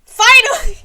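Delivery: sudden loud high cry of relief; relieved cheer. Real, not acted. Gamer cheering after finally finishing. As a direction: blurt it out like a long wait is over.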